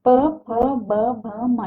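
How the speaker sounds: "प, फ, ब" stretched very slowly and softly, lips pressed; lip consonants. Shown as a demonstration. Teacher demonstrating the bilabial row very slowly. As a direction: close both lips on each of "प, फ, ब, भ, म". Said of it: "दोनों होठों से स्पर्श से ही होता है".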